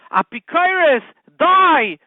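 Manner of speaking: high, loud shriek of rage; hammed-up zealot yell; mock furious scream. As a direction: scream it like a zealot attacking a heretic. Joke punchline, attacker screams at heretic.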